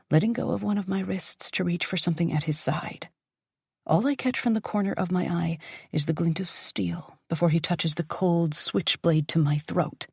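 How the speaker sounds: usual speaking voice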